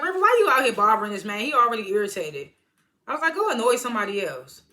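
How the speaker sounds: scolding River for bothering the man; teasing scold. Acted. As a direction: shoo him off like a nag. Scolding his friend in the scene.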